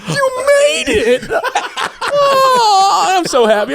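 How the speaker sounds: high, loud shout of relief, half crying; drunken joy. Retold or imitated. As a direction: wail with drunk gratitude. Drunk overjoyed at rescue.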